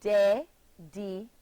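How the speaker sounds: "Dedicated" in four separated syllables, de, di, ca, ted; slowly, syllable by syllable. Shown as a demonstration. Teacher demonstrates chin counting, "Dedicated" slowly split into four syllables. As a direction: pause after each syllable of "Dedicated". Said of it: "let me do it for you".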